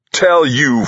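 high, loud outburst; angry shout. A narrator's reading. Delaney turns on Harry in the quarrel, angry shout. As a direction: throw it back at him, furious.

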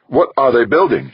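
high, loud demand. A narrator's reading. Westfall interrogating Devlin about the nanos, demanding. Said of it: "she demanded".

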